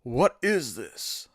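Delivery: slow, stiff, wooden line reading; bad game voice acting. Streamer mimics Resident Evil's bad voice acting, wooden. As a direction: read it like cheesy old game dub. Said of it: "Resident Evil one, bad voice acting".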